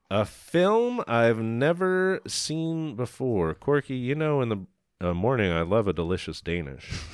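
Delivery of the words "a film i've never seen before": slow, higher dramatic announcement; drawn-out showman's reveal; grand announcement. Pretend. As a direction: announce it slowly, with ceremony.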